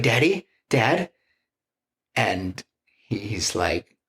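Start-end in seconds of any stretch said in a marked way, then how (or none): 0.0-1.1 put-on higher, childlike worried call; a small girl calling her dad; lightly voiced child calling her father; little girl calling.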